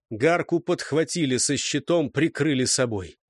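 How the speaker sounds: plain reading voice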